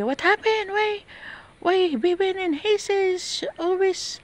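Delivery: high, far-off mock voice of the boss complaining; mock the boss's nagging in a high voice; mimicking the American boss. Imitating the American boss scolding her about the barking dogs.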